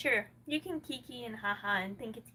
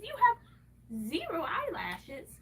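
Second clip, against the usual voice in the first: mock wail; 5 semitones higher, 6 dB louder than usual. Mock crying to herself.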